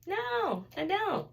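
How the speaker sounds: high, drawn-out no; high, emphatic mock denial; retold patient denial. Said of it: "do you normally have sensitivity".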